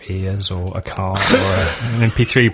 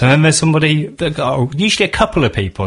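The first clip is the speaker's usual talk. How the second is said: usual speaking voice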